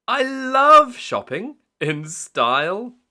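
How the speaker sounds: put-on high, loud and slow little girl; high piglet voice. A narrator's reading. Peppa telling Daddy she loved shopping, high and slow. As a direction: draw it out brightly like Peppa. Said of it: "said Peppa".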